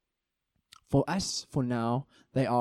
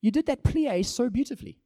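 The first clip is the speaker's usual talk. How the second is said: much higher, eager insisting; earnest insistence; 10 semitones higher than usual. Shown as a demonstration. Preacher insisting to a doubting friend, eager. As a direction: convince them you truly mean it.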